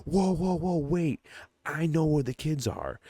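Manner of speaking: high, urgent imitation of begging; mock panicked plea. Man pleads at gunpoint in retelling.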